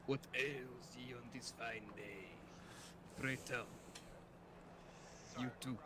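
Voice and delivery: put-on slow, old-fashioned Viking speech; lightly voiced Viking, unhurried. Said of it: "he spoke something like this".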